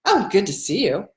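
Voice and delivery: insincere, sarcastic greeting; sardonic, unenthused hello; not welcoming. Shown as a demonstration. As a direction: greet them as if unwelcome. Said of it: "that's not welcoming".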